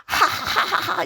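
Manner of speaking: slow high scoffing laugh; hammed-up giant's scoff; scornful laugh. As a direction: laugh at the tiny boy. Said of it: "얼마나 가소로웠겠어요".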